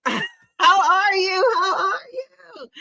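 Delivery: very high, slow squealing hello; excited squeal. Real, not acted. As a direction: squeal it like spotting a friend. Squealing greeting of a friend.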